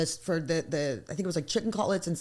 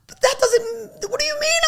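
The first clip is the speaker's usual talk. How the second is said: mock crying; 5 dB louder than usual. To her fiance who hates steak, hammed-up crying protest. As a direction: wail it like a wounded partner. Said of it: "I'm like".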